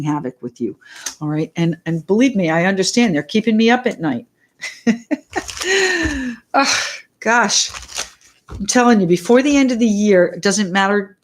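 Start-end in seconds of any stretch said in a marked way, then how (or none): 4.6-6.5 laugh. Real, not acted. Laughing about sleepless nights.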